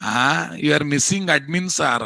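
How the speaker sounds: usual speaking voice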